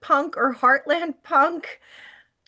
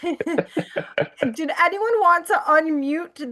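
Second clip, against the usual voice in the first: talking through a laugh; 6 semitones higher, much slower than usual. Real, not acted. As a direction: still giggling while asking. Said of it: "I can't stop laughing at farm emo".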